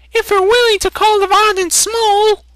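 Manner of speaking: very high, loud sarcastic woman's call. Acted. Woman heckling the traitor from the crowd, high and sarcastic. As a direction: shout it up high, dripping sarcasm. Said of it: "called a woman".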